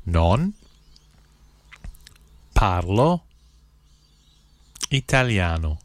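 each word set apart by long pauses; one word, pause, next word; slow, word by word. Shown as a demonstration. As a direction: spell it out slowly for learners to repeat. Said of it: "then I'll say one word at a time slowly".

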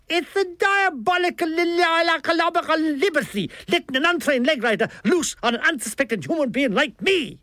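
high, stammering Dublin outrage; theatrical Dublin accent. A narrator's reading. Milligan protests at an untrained leg writer, theatrical Dublin outrage. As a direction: splutter indignantly in a high Dublin voice. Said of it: "charged with theatrical innuendo".

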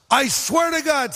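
high, emphatic "I swear to God"; exclaimed oath. Shown as a demonstration. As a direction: throw the oath up high and insistent. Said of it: "So here's how it works".

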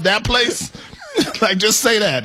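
ordinary talk, nothing marked

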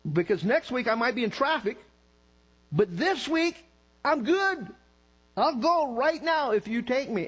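high-pitched, indignant retold rant; mad at God. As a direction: argue with God in mock exasperation, pitch climbing. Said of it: "I remember getting mad at him".

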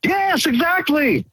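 high, drawn-out excited agreement; elated agreement. Real, not acted. Caller agreeing with the host about free money, elated.

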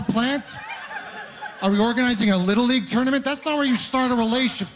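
incredulous comic rant, pitched high; mock outrage. Comedian on a first date at Tim Hortons, mock outrage. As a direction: rant in disbelief, pitch up throughout.

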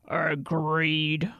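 grunting troll. Acted. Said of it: "grunted the troll".

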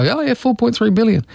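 high, breezy pretend acknowledgement; mock casual acceptance. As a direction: nod it through as if you understood. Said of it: "And we go".

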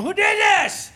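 high, loud outraged dad yell; re-enacted parental shout. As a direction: holler it in exasperation, acted. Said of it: "I started yelling".

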